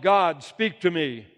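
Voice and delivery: loud, slow cry to God; yelling to God. Acted. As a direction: call out loudly and draw the words out. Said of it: "So I yelled".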